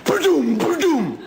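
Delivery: high, loud ba-doom blasts; mock gunshot sounds. Duck firing his shotgun at the hunter, loud mock blasts. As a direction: shout the shots like a cartoon gun.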